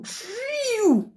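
mock wail. Mocking people who drill you.

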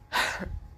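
wordless exhale before answering; sigh. Acted.

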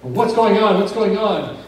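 bodyguard's alarm, hand on his pistol; alarmed guard. Retold or imitated. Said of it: "he's looking at both of them".

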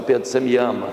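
low, calm asking; gentle question. Acted. Jesus first questions Peter's love, gentle. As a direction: ask it tenderly and privately.

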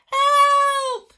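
high, very loud scream for help. A narrator's reading. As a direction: scream while falling. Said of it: "he screamed".

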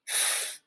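"f" as a drawn-out hiss of air; isolated phonics sound. Shown as a demonstration. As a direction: sound out "f" by itself, as in photo. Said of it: "sounds like F".

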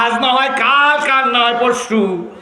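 high, loud rallying cry; full-force preaching. Real, not acted. Preacher promising the crowd victory, loud high proclamation.

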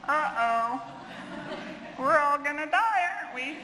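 hammed-up terrified dog; panicky dog voice. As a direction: voice the scared dog, half laughing. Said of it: "Dog's like".

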